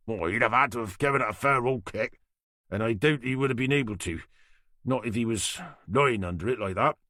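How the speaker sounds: a Norfolk boatyard man answering the inspector; thick Norfolk accent. Acted. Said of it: "he said with a thick Norfolk accent".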